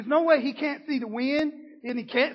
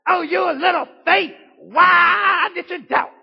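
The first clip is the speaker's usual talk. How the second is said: loud, high scolding shout; harsh rebuke; 7 semitones higher, 12 dB louder than usual. Retold or imitated. Jesus as wrongly imagined, shouting a harsh rebuke. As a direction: bark it like a preacher rebuking. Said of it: "you heard it preached like that".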